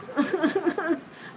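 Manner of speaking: high breathy giggle; delighted giggling. Real, not acted. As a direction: let the laugh bubble out. Laughs after hell yeah.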